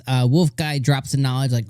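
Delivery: ordinary talk, nothing marked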